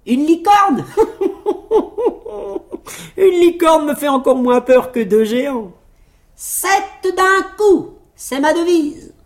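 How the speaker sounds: high, loud, scoffing bravado; boastful laughing tailor. Acted. Tailor answers the king about the unicorn, crowing with laughter. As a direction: laugh off the danger and crow.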